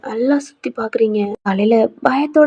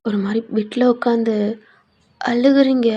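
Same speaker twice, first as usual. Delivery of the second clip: usual speaking voice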